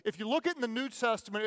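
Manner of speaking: ordinary talk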